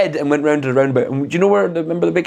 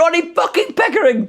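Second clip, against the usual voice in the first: high, strained mock road-rage yell; Ronnie Pickering. Friend imitates a furious driver, hammed-up outrage. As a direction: announce yourself in hammed-up outrage. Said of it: "like, that type of shit".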